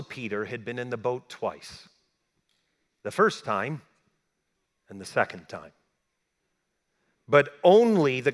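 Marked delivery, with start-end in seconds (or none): none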